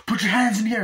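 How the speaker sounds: loud, high imitated cop shout; shouted order. Retelling cop confronting the driver, shouted order.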